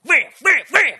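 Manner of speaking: loud, high shouted rams; describing ramming her car, shouted. Retold or imitated. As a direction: bang out each ram.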